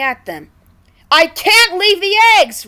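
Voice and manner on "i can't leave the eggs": high, loud desperate howl. Acted. Red refusing to leave the eggs, high loud howl. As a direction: howl it out in despair. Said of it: "Red howled".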